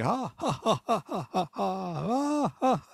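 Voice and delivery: high, repeated mock genie cackle; genie's laugh. Genie laughing as the money falls, mock cackle. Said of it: "he's got this laugh going on".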